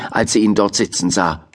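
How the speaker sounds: usual speaking voice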